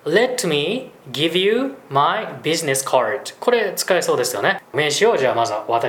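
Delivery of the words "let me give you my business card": very slow, careful English demo line; teacher modelling slowly; slow model phrase. Shown as a demonstration. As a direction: spell it out slowly for the learner. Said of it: "例えば最初に名刺を交換したりすると思うんですけど".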